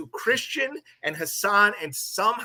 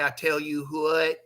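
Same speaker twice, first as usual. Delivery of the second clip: mock country accent; 3 semitones lower, 4 dB quieter than usual. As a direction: lower country twang, imitating. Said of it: "that sounded so country".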